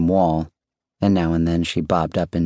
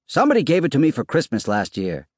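higher-pitched, put-out call; mildly cross; 8 semitones higher, faster than usual. A narrator's reading. Good-natured husband trying to be cross, mildly cross. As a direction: complain down the stairs, only a little cross.

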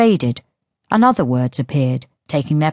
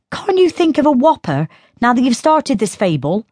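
put-on higher, light teasing girl's voice; girl Synth voice; 8 semitones higher than usual. A narrator's reading. Sister taunting Diello about his tale, in a higher teasing voice. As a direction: taunt him as the younger sister.

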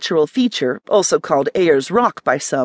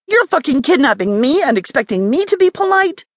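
higher, indignant outburst; 8 semitones higher than usual. A narrator's reading. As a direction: throw the outrage back at him. Angry captive snapping at captors.

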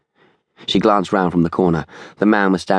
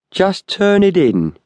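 high, drawn-out urging; slow, insistent tough, pitched well up; tall man's voice; 12 semitones higher, slower than usual. A narrator's reading. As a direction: press them slowly in a pitched-up character voice. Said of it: "said the tall man".

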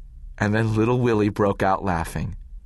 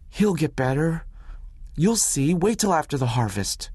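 put-on higher insistent boy's voice; boy to doctor, lightly voiced, insistent; young boy, stubborn; 7 semitones higher than usual. A narrator's reading. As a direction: refuse to believe it. Willie denies grandfather will die.

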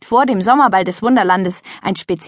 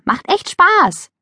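high, slow coaxing; urging; 11 semitones higher, 3 dB louder, slower than usual. A narrator's reading. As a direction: coax her into jumping. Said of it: "drängte Mia".

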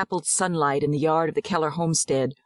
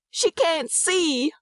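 high, drawn-out anguished cry; mother's slow, high cry of horror; horrified cry; 12 semitones higher, much slower than usual. A narrator's reading. Mother discovering her baby is blind, horrified cry. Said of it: "we see a terrible look upon her face".

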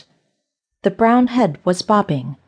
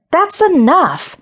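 a higher, louder outburst; shouted; 10 semitones higher, 5 dB louder, slower than usual. A narrator's reading. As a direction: stand and shout it to stop this. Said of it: "stood up and shouted".

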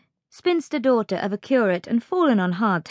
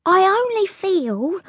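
high, slow, hesitant maid; careful little scullery maid; cautious; 12 semitones higher, slower than usual. A narrator's reading. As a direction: venture it timidly in a high voice. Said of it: "said Lizzie cautiously".

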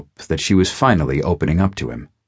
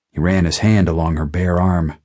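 ordinary delivery, nothing marked

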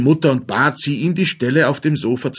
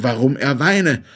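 ordinary delivery, nothing marked

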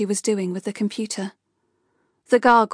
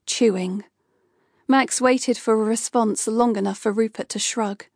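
plain reading voice

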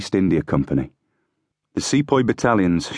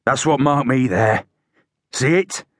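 higher-pitched, insistent showing-off; raised-pitch boast; crying out; 6 semitones higher than usual. A narrator's reading. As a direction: call it out to the men. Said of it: "he cried".

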